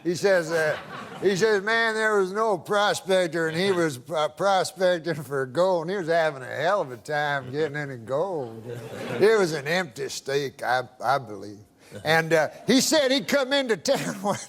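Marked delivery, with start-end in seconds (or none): 1.6-11.6 lower, folksy imitation of a Georgia yarn-spinner; slightly rambling Southern storyteller; Georgia good old boy. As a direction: spin it in a lightly put-on Southern accent. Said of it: "That's how he talks".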